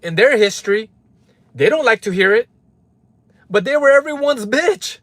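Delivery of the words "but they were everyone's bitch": high, punched out scornful line; emphatic jab. Real, not acted. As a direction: spit it out, pitch way up. First take of repeated jab.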